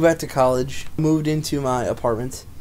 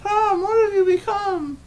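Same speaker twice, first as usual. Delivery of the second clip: much higher, crying despair; crying puppet lament. Pretend. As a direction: melodramatic sobbing puppet. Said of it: "do a puppet show".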